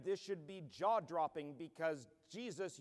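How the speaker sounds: ordinary talk, nothing marked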